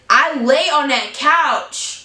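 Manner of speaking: outraged at the couch; indignant protest. Retold or imitated. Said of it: "And I was like".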